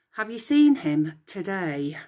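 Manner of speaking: ordinary delivery, nothing marked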